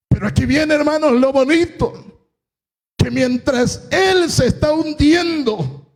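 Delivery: high-pitched, driving sermon delivery; impassioned preaching. Real, not acted. Preacher telling the congregation how Peter walked to Jesus, impassioned preaching. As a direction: preach it with full conviction.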